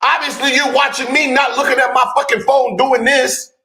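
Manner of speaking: defensive rant; heated. Real, not acted.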